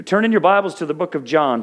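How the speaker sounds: ordinary talk, nothing marked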